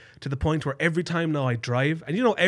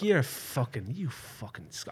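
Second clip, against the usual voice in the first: muttered mock curse; 8 dB quieter, slower than usual. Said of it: "the next day I was like".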